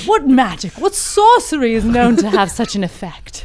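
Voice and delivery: British accent. Shown as a demonstration. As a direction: redo the wise men's line in an English accent. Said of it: "So please do it again".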